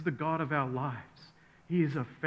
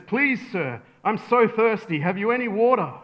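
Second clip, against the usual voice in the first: higher, louder acted begging; urgent pleading; 7 semitones higher, 7 dB louder than usual. Thirsty traveller begging a second camel rider, urgently pleading. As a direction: beg with growing desperation.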